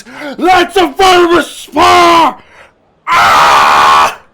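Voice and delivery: high, drawn-out full-force panic shout; panicked scream. Acted. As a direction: scream it like a brain sounding a false alarm. Imitates his panicking mind screaming.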